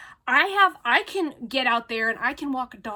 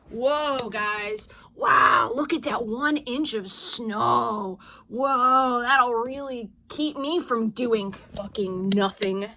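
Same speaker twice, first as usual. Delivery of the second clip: loud mock gasp of wonder, then slow and scornful; hammed-up wow, drawn out with contempt; sarcastic awe; 4 dB louder, much slower than usual. Real, not acted. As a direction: fake amazement at one inch of snow. Mocking the tiny snowfall.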